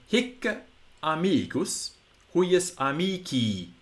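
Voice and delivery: hic as "hikk-uh", slow long final i in amici; restored Latin pronunciation. Shown as a demonstration. Latin teacher demonstrating the phrase, restored pronunciation. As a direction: set out hic amicus, huius amici word by word. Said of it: "pronunciation as it was heard".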